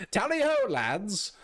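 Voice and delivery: loud, high, slow British "Tally ho, lads!"; British accent shout; British battle cry. Acted. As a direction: roar it like a redcoat firing the cannon. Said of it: "what accent am I doing".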